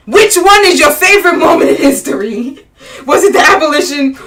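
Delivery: high, loud challenge through laughter; exasperated, laughing grilling; amused exasperation. Real, not acted. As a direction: demand an answer, loudly, half laughing. Mock-interrogating the absent answerer.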